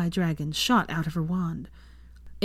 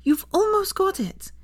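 higher pleading; begging. A narrator's reading. Hermione begging Draco for one more try, higher. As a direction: plead with him, high.

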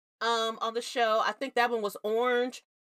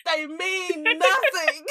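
laughing exasperation; 7 semitones higher, 6 dB louder, slower than usual. Real, not acted. Exasperated rant about relatives.

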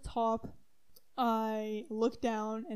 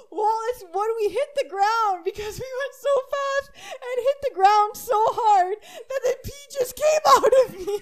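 higher and louder, laughing-crying through words; laughing explanation; 10 semitones higher, 7 dB louder than usual. Real, not acted. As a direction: explain while cracking up.

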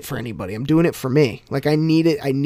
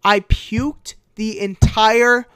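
excited storytelling; 8 semitones higher, 5 dB louder than usual. Real, not acted. Excited retelling of puking.

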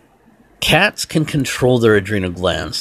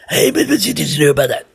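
higher, fast put-on voice of the street guy; imitating the guy; 8 semitones higher, 4 dB louder, faster than usual. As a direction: rattle it off like the tweaker outside. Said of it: "He sounds like that".

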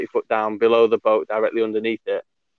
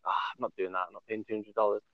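low, soft grumble to mates; half-voiced retold grumble; muttered refusal; 3 semitones lower, 12 dB quieter, faster than usual. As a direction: retell it as a quiet, dismissive mutter. Retold grumbled refusal among friends.

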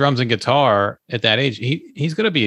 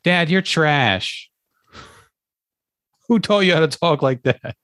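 commercial parody; 5 semitones higher, slower than usual. Retold or imitated. Acting out the commercial.

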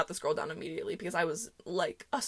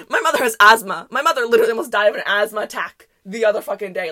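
loud, indignant rant; indignant retort; 10 dB louder, faster than usual. Retold or imitated. Daughter defending her asthmatic mother in her head, indignant. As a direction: hit it loud, as a retort you only wish you'd said. Retold angry inner retort.

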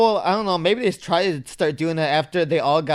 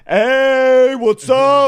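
higher, louder mock hey; hyped greeting; 7 semitones higher, 7 dB louder, much slower than usual. Friend mimics hosts' fake hype, loud mock greeting. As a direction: overdo the hello for effect. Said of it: "when they, like, introduce".

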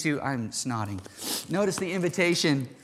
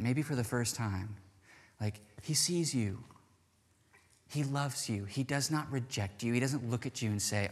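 softer, gentle and reassuring; tender voice of the Lord; 3 semitones lower, 6 dB quieter than usual. Acted. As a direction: speak as a loving Lord inviting in. Voicing the Lord's tender invitation.

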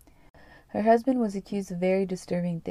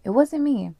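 higher, louder crying denial; mock tearful plea; 5 semitones higher, 7 dB louder than usual. A narrator's reading. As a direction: plead innocence as if starting to cry. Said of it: "Zach begins to cry".

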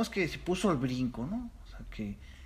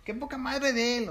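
indignant quote; 11 semitones higher than usual. Retold or imitated. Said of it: "hay unos que dicen".

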